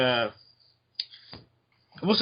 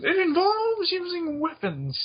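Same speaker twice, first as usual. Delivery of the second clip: high-pitched villain voice; slower than usual. Acted. Acting out a villain's line.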